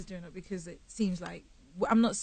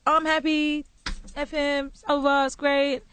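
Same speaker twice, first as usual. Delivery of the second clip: mock cheerful singer; 6 semitones higher, 7 dB louder, slower than usual. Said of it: "they're like".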